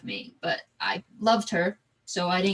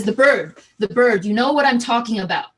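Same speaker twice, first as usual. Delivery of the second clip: loud menace; 3 semitones higher, 9 dB louder than usual. Retold or imitated. Intruder pressing the child.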